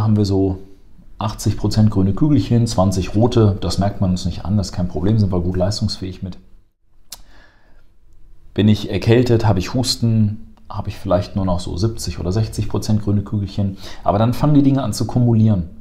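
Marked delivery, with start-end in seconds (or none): none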